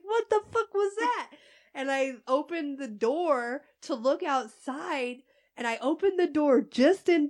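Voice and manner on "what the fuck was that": startled. Retold or imitated. Apartment shakes, startled.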